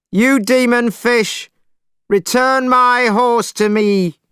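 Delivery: very high, slow, loud call. A narrator's reading. Monkey calling to the dragon in the water, loud. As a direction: bellow down into the river. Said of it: "he called into the water".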